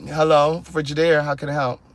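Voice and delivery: mock sleepy yawn through the greeting. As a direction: imitate a bored rep half asleep. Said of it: "She yawning all in my ear".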